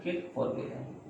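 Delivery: ordinary talk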